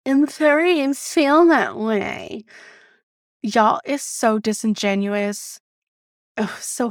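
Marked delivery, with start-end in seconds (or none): 0.0-2.4 slow, high-pitched mocking voice; exaggerated mimicry of a phony apology; mocking imitation. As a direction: parrot it back in a drawn-out, raised voice. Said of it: "angers me more than statements like".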